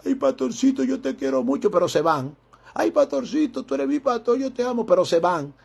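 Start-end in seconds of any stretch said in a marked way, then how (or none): 0.1-1.7 high, sugary mock voice of a fawning member; simpering high mock voice; mocking whiny churchgoer. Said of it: "no se van a ir llorando". 2.7-4.9 high, sugary mock voice of a fawning member; simpering high mock voice; mocking whiny churchgoer. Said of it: "no se van a ir llorando".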